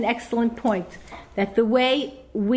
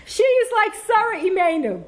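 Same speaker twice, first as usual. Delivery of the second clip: much higher mock of her husband, leaning on is; imitating husband; 10 semitones higher, 3 dB louder than usual. Mocking her husband's comparison to Sarah, a much higher imitation. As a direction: ham up his line, stressing is. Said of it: "He said".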